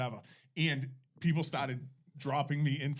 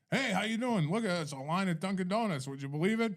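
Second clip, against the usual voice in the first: higher-pitched chummy Rhode Islander; Providence Italian guy; 5 semitones higher than usual. Retold or imitated. Italian tourist greets another in the donut line, chummy Providence voice. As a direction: hail a countryman in a Providence accent. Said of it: "he's like".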